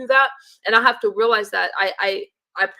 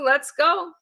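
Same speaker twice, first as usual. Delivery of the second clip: laughing; 6 semitones higher than usual. Real, not acted. Laughing at a viewer's joke.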